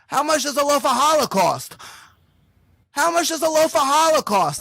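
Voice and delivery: high, rising mock yell; yelling at a deaf lady. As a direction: shout it up to someone hard of hearing.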